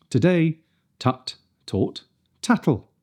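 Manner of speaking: "Today, tut, taught, tattle" with clean t; crisp t in each word. Shown as a demonstration. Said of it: "Nice and crisp".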